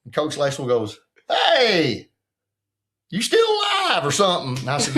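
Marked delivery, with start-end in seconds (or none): 1.3-4.6 coach's teasing shout. Retold or imitated. Coach greets the missing player.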